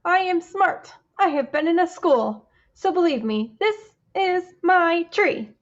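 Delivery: loud, high and proud, bragging; a loud bragging fish; boastful fish voice. Acted. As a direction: puff up like a smug little fish. Fish character boasting in story.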